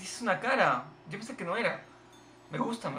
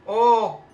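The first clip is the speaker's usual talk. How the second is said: high, loud exclamation; loud surprised "oh"; 6 semitones higher, 13 dB louder than usual. Real, not acted. Player reacting to his shot, loud surprise. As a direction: let out a big startled oh.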